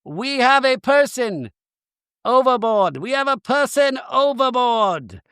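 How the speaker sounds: high-pitched mayday call; Captain Jason. Retold or imitated. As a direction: announce an emergency to all crew, phrase by phrase. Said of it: "Captain Jason's like".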